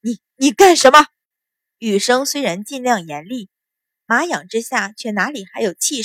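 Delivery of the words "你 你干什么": loud stammered challenge, raised pitch; loud shout. Acted. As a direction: shout it out, tripping on the first word. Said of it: "大声喝道".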